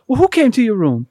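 loud, high demanding question; alarmed mother. Retold or imitated. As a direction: press the child in alarm.

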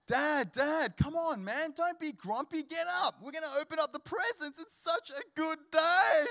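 put-on high, eager kid voice, shouting; a small boy bouncing on dad's bed; excited little son. Son shouting at grumpy dad to get up.

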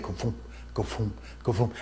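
soft, low booming heartbeat sound; imitated heartbeat. Preacher imitating the heartbeat in his vision, soft low booms. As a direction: thump it out like a heartbeat. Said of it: "a heartbeat sounded".

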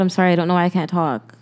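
ordinary talk

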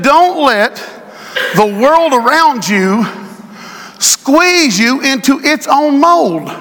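slow, drawn-out reading; scripture preached. Acted. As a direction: stretch each phrase for weight.

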